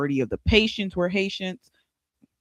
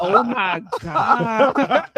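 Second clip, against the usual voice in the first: mock shocked gasp; 6 semitones higher, 6 dB louder, much slower than usual.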